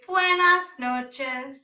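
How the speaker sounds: put-on slow, careful "Buenas noches" for kids to repeat; modelled Spanish. Shown as a demonstration. Teacher modelling a greeting for students, slow and clear. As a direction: spell out "Buenas noches" slowly. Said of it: "everybody say".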